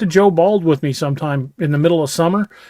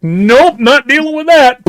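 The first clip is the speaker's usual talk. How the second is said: raised, higher retold exclamation; emphatic refusal; 8 semitones higher, 5 dB louder, slower than usual. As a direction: blurt it loudly, rising high, then fire. Retells his startled refusal before shooting.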